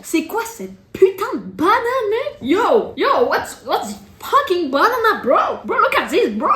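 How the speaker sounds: high, hyped TikTok reactor voice; New Yorker impression. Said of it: "Comme ça, ils font".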